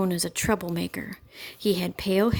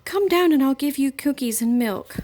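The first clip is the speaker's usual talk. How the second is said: coaxing; 6 semitones higher, 4 dB louder than usual. A narrator's reading. Mother coaxes girl down.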